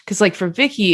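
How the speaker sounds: usual speaking voice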